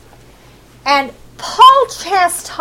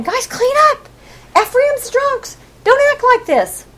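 much higher-pitched admonishing voice; urgent scolding; 10 semitones higher than usual. Acted. Isaiah telling the tribes to clean up, scolding. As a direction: plead with them to shape up.

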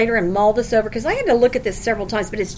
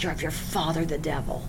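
much softer, low and hard; low cold rebuke; 8 semitones lower, 12 dB quieter than usual. Acted. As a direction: drop to a cold quiet warning. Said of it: "And he turns on them".

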